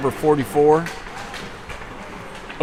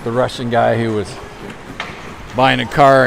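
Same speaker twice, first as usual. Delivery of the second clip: usual speaking voice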